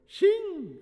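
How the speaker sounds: high, loud exclamation; old man's cry. A narrator's reading. Old priest hearing of a song, crying out. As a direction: cry out in an elder's cracked excitement. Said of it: "cried the old man".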